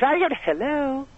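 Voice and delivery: high, drawn-out sweet hello; mock mother answering the phone, slow and high; switched-on phone voice. Guest shows mother suddenly answering the phone, high sing-song hello. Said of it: "The phone rings".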